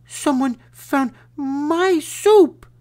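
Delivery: put-on tiny baby bear squeak, slow; high squeaky voice. A narrator's reading. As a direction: squeak it like a small cub. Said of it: "in her high, squeaky voice".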